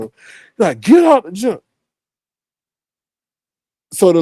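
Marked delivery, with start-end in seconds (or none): 0.8-1.6 higher-pitched supervisor imitation; mock angry order. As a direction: mimic a boss kicking someone out. Mimics supervisor's order again.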